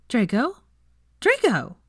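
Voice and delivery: rising to a loud high call. Acted. Veronica calling dazed Draco, rising to loud shout. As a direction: snap him out of it.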